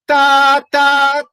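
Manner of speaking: forte "tá", high and loud. Shown as a demonstration. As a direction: belt "tá" high, keeping the pitch. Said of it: "cuidado de não fazer". ("tá" forte, agudo)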